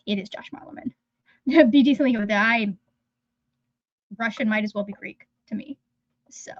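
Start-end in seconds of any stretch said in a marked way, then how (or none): 0.1-0.9 whisper. Real, not acted. Reading author name aside.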